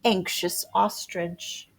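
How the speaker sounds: plain reading voice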